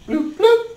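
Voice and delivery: squeaky high-pitched bloop noise; high bloop sound effect. Acted.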